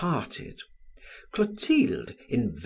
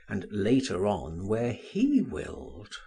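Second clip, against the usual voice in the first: ordinary delivery, nothing marked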